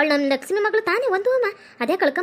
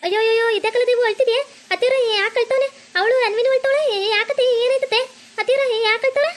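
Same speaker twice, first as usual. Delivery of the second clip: high, put-on questioning voice; concerned family woman; 7 semitones higher than usual. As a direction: ask anxiously in a high character voice. Plays relative alarmed by crying children. (ಮಕ್ಕಳ ಅಳು ಕೇಳಿ ವಿಚಾರಿಸುವ ಎತ್ತರದ ಧ್ವನಿ)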